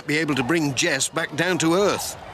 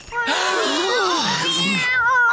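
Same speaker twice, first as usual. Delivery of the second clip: loud high gasp of alarm; 3 dB louder than usual. Acted. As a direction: gasp as the kite lifts her. Kite carries Jess away.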